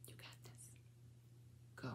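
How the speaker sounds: faint, conspiratorial whisper; whispered encouragement. Real, not acted. Grandmother encouraging the child before his turn, whispered. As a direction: whisper support just before the start.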